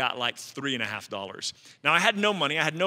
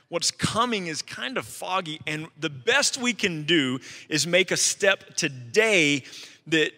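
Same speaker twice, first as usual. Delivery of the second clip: sermon preaching; 3 semitones higher than usual. Acted. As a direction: preach it to the room with conviction. Preaching to congregation.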